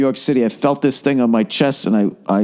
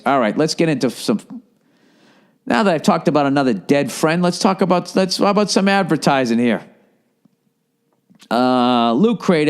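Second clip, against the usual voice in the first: ordinary delivery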